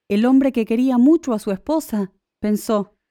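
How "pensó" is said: plain reading voice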